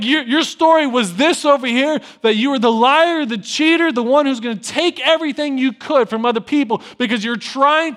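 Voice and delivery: high, loud, building declaration; preaching at full force. Acted. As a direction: thunder it from the pulpit. Preaching God's words to Jacob.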